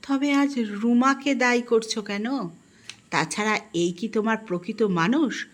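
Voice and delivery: plain reading voice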